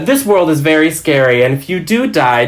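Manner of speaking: customer service voice with menace underneath; friendly but vaguely threatening. Shown as a demonstration. IKEA worker warning a customer about death, friendly threat. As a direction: warn them politely, as staff would. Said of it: "a mixture of vaguely threatening and, like, very friendly".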